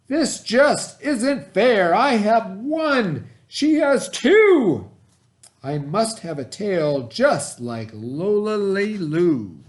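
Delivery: high, loud, very slow jealous wail; stretched-out, high-pitched whiny shout; shouted complaint. Acted. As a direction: cry out that it isn't fair. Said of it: "she shouted".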